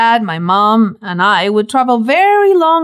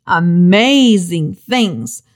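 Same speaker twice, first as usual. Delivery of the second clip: "amazing things" drawn out slowly and lower; slow emphasis; 5 semitones lower, much slower than usual. Real, not acted. Preacher insisting to listeners, slow stressed emphasis. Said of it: "No, really".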